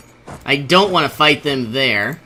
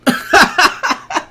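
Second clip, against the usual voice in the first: high laugh; 14 semitones higher, 3 dB louder than usual. Real, not acted. Laughs at game outcome.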